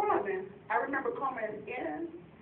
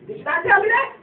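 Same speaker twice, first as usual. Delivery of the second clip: usual speaking voice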